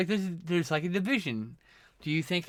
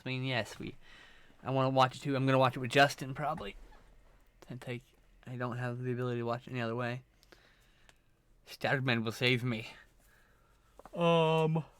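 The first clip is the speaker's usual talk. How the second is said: normal talking voice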